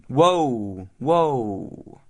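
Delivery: drawn-out "whoa" to rein in an eager pupil; slow him down as if he were galloping; long, falling calls to stop; teacher halting pupil. A narrator's reading. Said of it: "held up his hands as though Billy were galloping".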